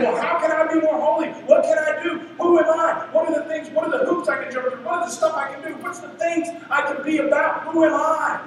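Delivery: fretting over what to do; anxious believer. Retold or imitated.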